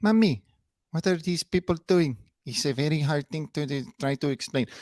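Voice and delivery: imitating a mother's exaggerated Spanish-accented English; fake Spanish accent. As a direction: parody her put-on Spanish accent. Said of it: "full-blown fake Spanish persona".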